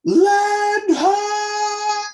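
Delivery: long drawn-out high sung call; shouting from crow's nest. Acted. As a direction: stretch it out like a sailor's cry.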